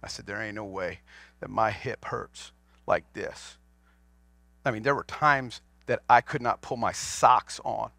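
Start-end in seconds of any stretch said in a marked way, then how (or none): 0.2-3.6 hushed disbelief. Retold or imitated. His own disbelief quoted.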